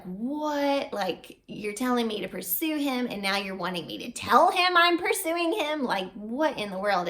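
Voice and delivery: what, are you serious; rising shock at being told to speak up; incredulous. Retold or imitated. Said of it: "I was just like".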